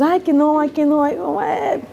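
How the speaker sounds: mock-whiny mother protesting; whiny mom refusing. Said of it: "she goes".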